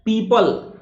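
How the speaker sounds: high, falling पीपल; long 'ee' in पीपल, like the tree; model pronunciation. Shown as a demonstration. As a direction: announce पीपल as 'pee-pal'. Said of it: "पीपल कहा जाता है".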